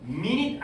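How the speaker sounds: "mean it" said as MIN-it, like the time unit; wrong pronunciation. Shown as a demonstration. Teacher showing students the reading to avoid, MIN-it for "mean it". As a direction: say "mean it" with short vowels, MIN-it. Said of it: "아니에요".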